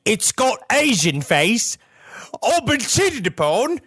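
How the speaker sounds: high, slow, wailing Australian-turned-Cockney; bad Australian accent, Cockney-sounding. Ghost father discovering he was betrayed, mock-distraught Australian-Cockney accent. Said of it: "that's Cockney".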